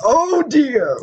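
high, hammed-up gasp of dismay; mock dismay. Real, not acted. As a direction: play shocked for laughs.

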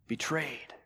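a hushed, breathy whisper of Betrayed; soft sermon whisper. Acted. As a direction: drop to a whisper on the final word. Whispered refrain word.

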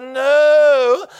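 short loud flat no; a curt, loud refusal at the gate; sharp refusal. Retold or imitated. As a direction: shut him down loud and high. Gate agent flatly refuses.

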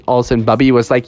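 usual speaking voice

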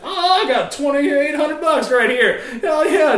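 high, loud, slow gloating; laughing mock gloat. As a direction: gloat over the cash. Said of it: "sitting there Googling shit".

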